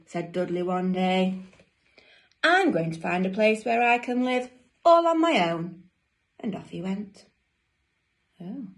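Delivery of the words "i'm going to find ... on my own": higher, louder storybook resolve; fed-up declaration. Acted. Dudley off to live alone, loud fed-up declaration. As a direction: announce it loudly and emphatically.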